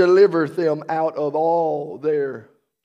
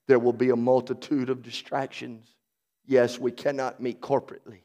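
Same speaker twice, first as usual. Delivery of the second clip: ordinary delivery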